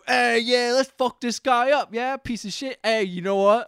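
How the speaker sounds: high, rowdy imitation of thugs; hammed-up goading; mock bullies. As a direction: play a hyped-up bully egging on a pal. Acting out two bullies ganging up.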